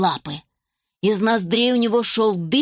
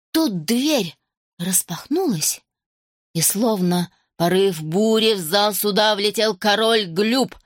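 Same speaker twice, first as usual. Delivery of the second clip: ordinary delivery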